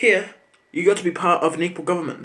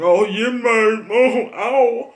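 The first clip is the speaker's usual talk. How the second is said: slow, drawn-out, louder, higher boozy holler; mock drunk gang yell; 6 semitones higher, 7 dB louder, much slower than usual. Imitating yelling gang members outside, slow drunken holler. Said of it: "they're yelling, going".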